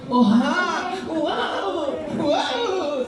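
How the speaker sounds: put-on American baby. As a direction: play an American newborn crying, amused. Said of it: "Kahit Amerikano".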